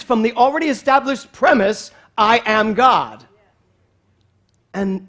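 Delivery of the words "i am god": slow, loud I am God; preacher proclaiming I am God; bold declaration. Real, not acted. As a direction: proclaim it with conviction.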